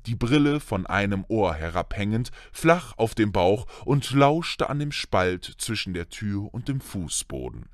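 usual speaking voice

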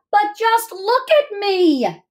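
high, drawn-out storybook wail; slow, hammed-up exclamation; dramatic dismay. A narrator's reading. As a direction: stretch it out high, as a dismayed child. Child's dismay in story read aloud.

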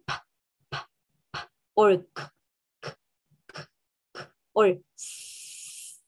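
"p", "k", "s" made on breath alone; no throat vibration on "p", "k", "s"; voiceless consonant demo. Shown as a demonstration. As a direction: isolate "p", "k" and a long hissed "s". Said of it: "There is no vibration".

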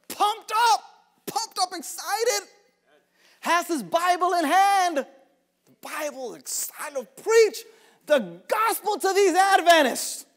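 high, hyped-up preacher energy; punched, high-pitched enthusiasm; pumped up, excited. Retold or imitated. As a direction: act out a young preacher raring to go. Acting out his brother's eager excitement.

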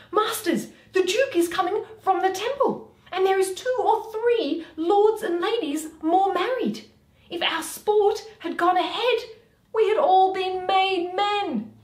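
high, loud excited Snug. Acted. Snug bursting in to the players with news, high and loud.

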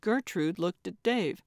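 ordinary delivery, nothing marked

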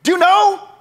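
very high-pitched taunting demand; mocking. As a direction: taunt him, pushing each question high. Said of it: "he's kinda mocking him right now".

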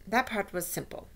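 ordinary delivery, nothing marked

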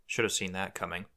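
usual speaking voice

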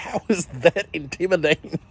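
laughing. Retold or imitated. Laughing at scared neighbor.

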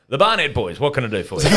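teasing shopkeeper voice; cheeky bottle-o coach. Retold or imitated. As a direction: hail the boys knowingly. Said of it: "He just looks at me and goes".

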